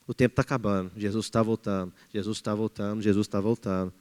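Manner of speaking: a hushed, grave repetition of the warning; hushed urgency. Real, not acted. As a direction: drop the voice and let the warning sink in. Solemn repeated warning.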